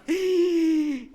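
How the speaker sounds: a real burst of laughter; hearty laugh. Real, not acted. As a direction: pastor laughing at the collection joke. Laughing at own joke.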